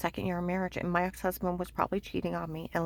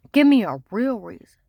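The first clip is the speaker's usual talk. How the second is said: louder impatient demand; retold demand; 2 semitones higher, 11 dB louder than usual. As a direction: push him for a real reason.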